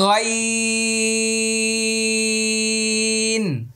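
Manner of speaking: গইন held very long and high from the throat; slow tajweed demo of গইন; letter name drawn out. Shown as a demonstration. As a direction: stretch গইন out on one held note. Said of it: "আবার লক্ষ্য করুন".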